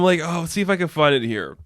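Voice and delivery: normal talking voice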